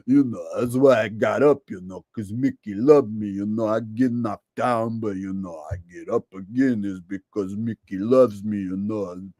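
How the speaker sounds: lightly voiced mumbling Rocky; Stallone impression. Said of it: "Sylvester Stallone impression".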